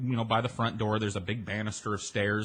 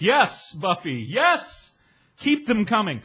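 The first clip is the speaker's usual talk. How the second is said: louder, higher eager acceptance; eager yes; 8 semitones higher, 5 dB louder, slower than usual. Retold or imitated. He tells the bartender to keep drinks coming, loud eager yes. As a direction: say yes like free drinks are a dream.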